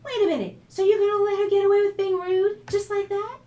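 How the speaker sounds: high, loud indignant protest; shrill-free loud disbelief; upset outburst. A narrator's reading. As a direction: burst out, outraged at her sister. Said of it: "She wasn't yet ready for the lighthearted conversation".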